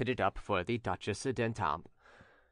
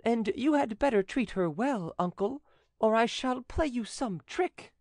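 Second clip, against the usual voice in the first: high-pitched youth with a playful threat; high, knowing nephew; young nephew; 10 semitones higher than usual. Acted. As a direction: tease the uncle in a high young voice.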